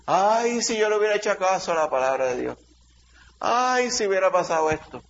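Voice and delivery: ay agudo de arrepentimiento fingido; lamento imitado. Retold or imitated. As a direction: imita el lamento de quien no obedeció. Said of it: "Eso es lo que va a pasar ese día". (el predicador imita a los que no hicieron caso, con un ay agudo)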